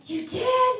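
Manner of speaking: squeaky high, amazed question; can't believe what she just heard; very high, delighted disbelief. Retold or imitated. Team amazed at her story.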